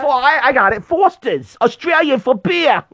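high, loud Australian ad parody; mock Australian accent. Dad attempting a beer slogan, mock Australian accent. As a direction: pitch it up into a hammed-up Australian.

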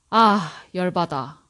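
아, 열받아 said fast with a quick sighing 아 before it; natural casual Korean. Shown as a demonstration. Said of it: "When you put 아 in front of 열받아".

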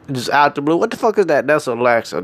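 normal talking voice

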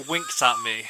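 ordinary talk

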